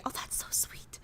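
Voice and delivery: soft, whispered reaction; whispered aside. Real, not acted. Streamer reacting to a fan's sweet message, whispered.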